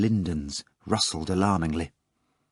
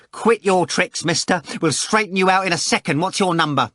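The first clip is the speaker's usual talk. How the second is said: high-pitched, indignant threat; angry roar; 9 semitones higher than usual. A narrator's reading. Manager threatening a prank caller, roaring. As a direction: fire it angrily at the caller. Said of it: "Varyonika roared".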